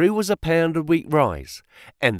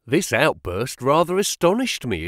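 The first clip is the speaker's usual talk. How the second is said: ordinary delivery, nothing marked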